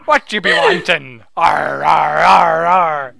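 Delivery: loud pirate arrs; hammed-up pirate muttering arr. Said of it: "translated as translated".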